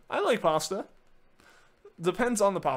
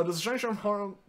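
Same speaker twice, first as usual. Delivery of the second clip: usual speaking voice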